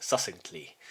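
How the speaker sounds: "succinctly" as suh-sink-lee, low and soft; mistaken pronunciation. Real, not acted. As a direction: leave out the first k sound in "succinctly". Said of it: "I just made a mistake now".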